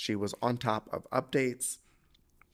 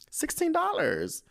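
very high, drawn-out squeal; slow squeak of outrage; incredulous. Real, not acted. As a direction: stretch it out high in disbelief. Disbelief at the burger's price.

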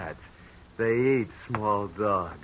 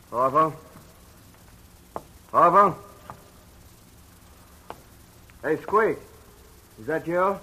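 calling out; 7 semitones higher, 5 dB louder than usual. Acted. Gunman calls into dark foundry.

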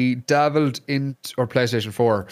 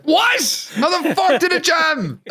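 much higher, louder shout with a laugh through it; outraged disbelief; 4 dB louder, slower than usual. Retold or imitated. Gamer reliving gun jam, shocked laughing shout. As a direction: yelp in shock as the gun jams. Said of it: "I was like".

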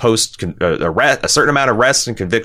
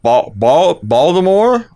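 higher, louder mock Baltimore try at "Baltimore"; Baltimore accent; 8 semitones higher, 4 dB louder, slower than usual. Shown as a demonstration. Testing the accent on his co-host: high mock Baltimore. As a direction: stretch the O in "Baltimore" up into a question. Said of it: "kinda got a little bit of that Wayne".

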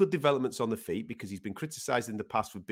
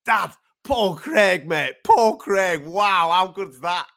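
imitating an excited son, higher, louder and slow; excited kid; 8 semitones higher, 9 dB louder, much slower than usual. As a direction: gush it like a nine-year-old messaging his dad. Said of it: "He goes".

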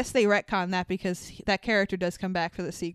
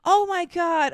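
sharp high gasp, then a loud oh my God; gasp of mock shock; 8 semitones higher, 5 dB louder than usual. Real, not acted. Told about a talking dog, gasping loudly in shock. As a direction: gasp like the news is a scandal.

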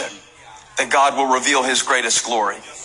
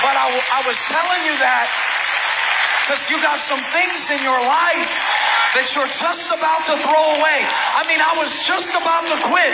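full-force preaching; 14 semitones higher than usual. Acted. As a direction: preach it out with rising fire. Preaching climax to congregation.

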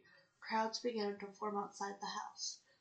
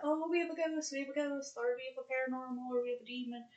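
mock ghost believers; 4 semitones higher, 5 dB louder, faster than usual. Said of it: "a bunch of people telling you that".

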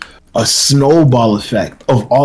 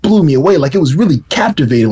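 usual speaking voice

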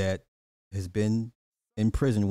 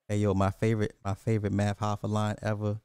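usual speaking voice

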